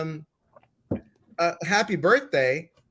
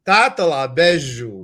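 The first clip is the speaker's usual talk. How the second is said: loud, playful mock Italian; accidental Italian accent on Portuguese; put-on Italian accent; 8 dB louder than usual. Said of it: "I'm making an Italian accent".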